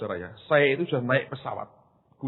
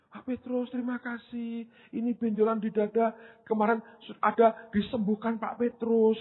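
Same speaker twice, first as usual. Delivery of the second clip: mock grateful caller; 9 semitones higher than usual. Imitating a radio caller.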